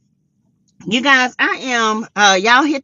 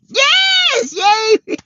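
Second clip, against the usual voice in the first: high shouted yes yay; excited cheer; 4 dB louder than usual. Real, not acted. Host cheering the viewer count going up, excited whoop.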